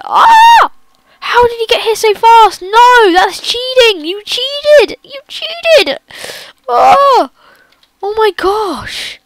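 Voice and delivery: very high, loud frightened shrieks; screaming in terror at the cheat; panicked scream. Real, not acted. Slender appears suddenly.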